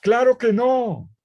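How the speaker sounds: loud, high and drawn-out denial; slow, forceful exclamation to the congregation; emphatic no. Real, not acted. Preacher answering his own question, slow and loud.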